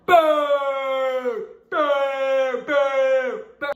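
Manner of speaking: loud mocking boos; crowd booing. Retelling the crowd booing her off stage, loud boos. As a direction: boo like the whole crowd. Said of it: "the whole crowd".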